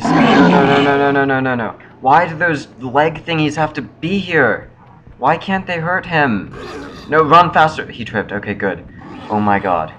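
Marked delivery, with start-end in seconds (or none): 0.0-7.9 high frantic pleading; panicked cries, voice pitched up; being chased. Real, not acted.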